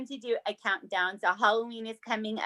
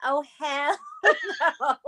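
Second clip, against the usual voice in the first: oh hell no through laughter; laughing; 4 semitones higher, slower than usual. Real, not acted. Reacting to husband's mess, laughing. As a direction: laugh it out in disbelief.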